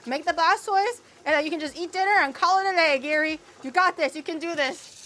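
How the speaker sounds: loud, high self-urging; rousing coach-like shout of encouragement; forceful pep talk. Real, not acted.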